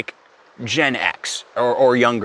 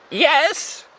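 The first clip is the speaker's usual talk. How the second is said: put-on high-pitched old lady voice; old lady Janet; 13 semitones higher than usual. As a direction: answer in a thin put-on elderly woman's pitch. Voicing elderly Janet answering prankster.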